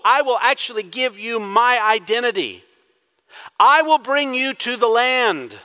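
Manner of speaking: fervent sermon climb, each promise pushed harder; preaching at full force. Acted.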